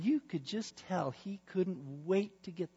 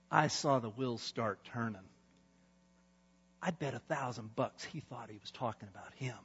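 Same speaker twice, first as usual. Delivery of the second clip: ordinary talk, nothing marked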